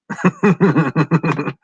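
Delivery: loud high burst of laughter. Real, not acted. Streamer laughing at a viewer's jab, high and loud. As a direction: crack up at the chat.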